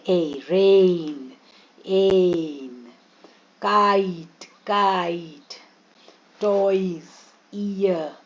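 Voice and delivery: रेन, काइट, कोट with long drawn-out vowel glides; teacher stretches रेन, काइट, टॉयज word by word; double vowel sounds. Shown as a demonstration. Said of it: "अब double vowel sounds आते हैं".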